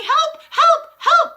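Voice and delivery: very high, loud squeals for help; panicked yelling. A narrator's reading. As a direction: teachers shrieking for rescue. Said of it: "holding up skirts and yelling".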